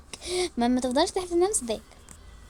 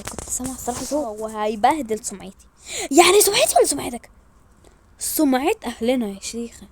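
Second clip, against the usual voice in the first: ordinary talk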